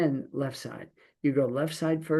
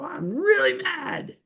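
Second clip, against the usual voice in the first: high, slow, strained mock complaint; mock angry cry at God; 14 semitones higher, slower than usual. Believer shaking a fist at God, high mock protest. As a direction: wail it up to God like a hurt child. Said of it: "shake our fist at God and go".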